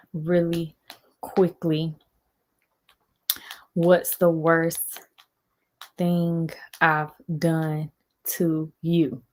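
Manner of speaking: very slow, word by word; slow, halting dictation; dictating while typing. Real, not acted. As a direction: read out a text as you type it, with long gaps. Speaks words while typing a text.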